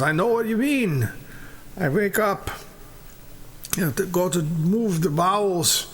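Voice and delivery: put-on high-pitched elderly character; second old man. Second old man matches friend's complaint, high character voice. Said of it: "The second guy goes".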